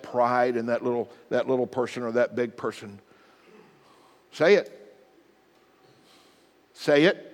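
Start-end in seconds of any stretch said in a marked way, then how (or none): 4.3-7.2 pitched up, louder insistence; firm parental command. Retold or imitated. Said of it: "parent demanding an apology".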